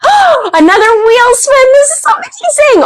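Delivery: high-pitched gasping delight; excited. Real, not acted. Host thrilled to announce a wheel spin, squealing high.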